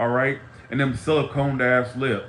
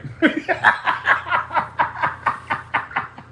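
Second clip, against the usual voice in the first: breathy laugh falling away, high; real airy laughter; high wheezing laugh; 13 semitones higher than usual. Real, not acted. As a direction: wheeze out a laugh at your own joke. Laughing at own joke.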